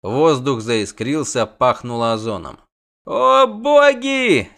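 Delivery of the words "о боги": high, slow, loud groan of wonder; awed moan. Acted. Dandelion marvels at the spell, slow high moan. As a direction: stretch it out in awe. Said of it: "изумительно простонал Лютик".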